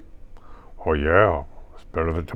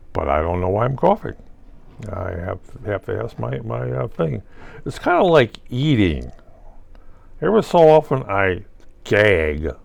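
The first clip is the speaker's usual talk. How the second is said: ordinary delivery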